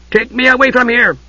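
high, commanding priestess voice. A narrator's reading. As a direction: order it sharply from above. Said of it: "the priestess ordered".

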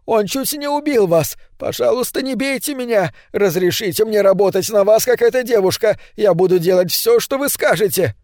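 high panicked pleading; hysterical begging. Acted. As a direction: beg for mercy in hysterics. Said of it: "умолял Свансон". (истеричная мольба, голос высоко)

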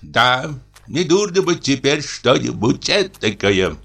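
agreeing inspector voice. A narrator's reading. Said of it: "согласился инспектор".

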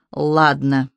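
low hoarse rasp. A narrator's reading. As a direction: rasp it from below. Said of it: "хрипло прозвучало снизу".

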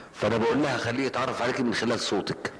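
ordinary talk, nothing marked

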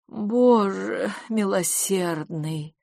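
very slow, fading exclamation; dying voice. A narrator's reading. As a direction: stretch it out as if expiring from shock. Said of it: "умирающим голосом произнесла". (умирающим голосом, протяжно)

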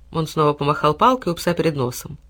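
ordinary delivery, nothing marked

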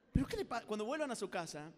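ordinary talk

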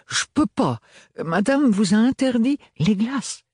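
put-on high young maid with a thick provincial French accent. A narrator's reading. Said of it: "L'accent très prononcé de l'adolescente".

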